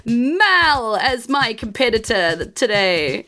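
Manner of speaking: high, proud speech; boastful rival father. Acted. Rival father brags about his son, high and proud. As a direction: brag to the crowd. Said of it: "he says that".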